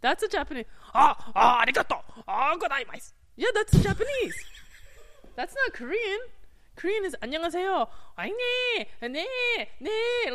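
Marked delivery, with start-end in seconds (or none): 0.9-3.1 louder hammed-up Japanese accent; mock Japanese accent, bowing thanks. Shown as a demonstration. Demonstrating a Japanese accent to the host, hammed-up and loud. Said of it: "that was Japanese accent". 6.8-10.3 안녕하세요 fast with 네 rising high at the end; Korean intonation. Shown as a demonstration. Showing the host how Korean rises, 네 pitched high. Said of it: "they go up at the end".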